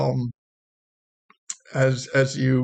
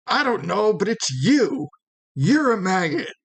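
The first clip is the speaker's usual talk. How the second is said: higher, cocky schoolyard comeback; Romeo; 7 semitones higher than usual. Acted. Romeo trading insults with a guard in a higher cocky voice. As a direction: toss the insult back with swagger. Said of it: "you hear a familiar voice".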